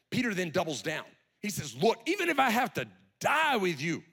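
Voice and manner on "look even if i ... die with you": insistent vow. Acted. Peter doubling down.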